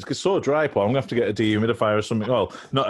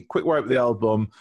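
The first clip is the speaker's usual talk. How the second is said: ordinary talk, nothing marked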